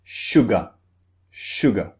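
"sugar" ending in a short, unstressed "uh"; schwa ending. Shown as a demonstration. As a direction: finish "sugar" on a relaxed "uh", falling away. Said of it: "in the second syllable, we have the sound".

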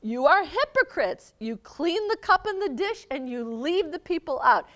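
loud, high yelling rebuke; yelling Jesus. Acted. Jesus yelling at the Pharisees, loud and high. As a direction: yell it at the hypocrites. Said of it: "he starts yelling at them".

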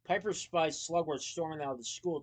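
ordinary delivery, nothing marked

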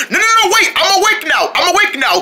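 high, hurried pleading; panicked protest. Acted. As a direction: beg them to stop the treatment. Patient protests another shock.

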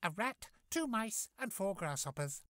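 high, kindly fairy voice; sweet magical godmother. Acted.

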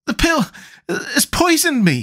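very high, slow gasp of pain; gasped alarm. A narrator's reading. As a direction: gasp it out as if poisoned. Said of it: "he gasped".

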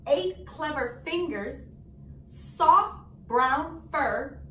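ordinary delivery, nothing marked

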